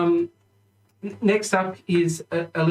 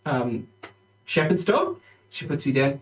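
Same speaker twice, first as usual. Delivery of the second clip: ordinary talk, nothing marked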